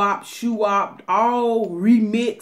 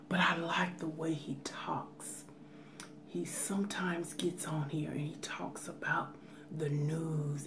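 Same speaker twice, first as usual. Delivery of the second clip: very soft, whispered ASMR murmur; soft-spoken imitation; 2 semitones lower, 15 dB quieter than usual. Telling viewers about a favorite ASMR man, soft-spoken. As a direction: imitate his hushed, soft-spoken way of talking. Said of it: "I'm not mocking him".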